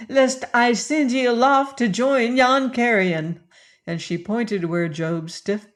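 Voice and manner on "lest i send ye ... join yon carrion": high, louder fierce command; commanding cry. A narrator's reading. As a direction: order him off the deck. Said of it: "cried she".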